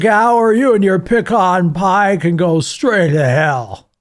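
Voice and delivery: put-on slow, theatrical old-film villain; old movie voice. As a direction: snarl it like a 1940s film baddie. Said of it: "I've been practicing that voice today".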